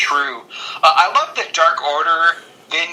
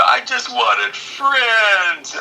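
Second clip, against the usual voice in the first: high, drawn-out mock wail; mock crying; 7 semitones higher, much slower than usual. Host imitates the unhappy wrestler, mock crying. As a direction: imitate a hammed-up pitiful sob. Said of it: "he's just crying".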